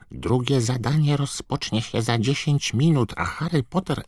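high, squeaky elf urging; croaking house-elf. A narrator's reading. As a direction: squawk like an urgent house-elf. Dobby voiced by narrator. (skrzeczący, wysoki głos skrzata)

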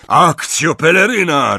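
loud, high, bellowing spell; roar. Acted. Unseen Death Eater roars a summoning spell, bellowing. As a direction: roar it across the street. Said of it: "ryknął ktoś".

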